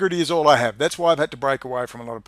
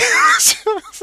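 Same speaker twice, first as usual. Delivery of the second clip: high laugh; 6 dB louder than usual. Real, not acted. Recalling the English copper's offer, high laugh.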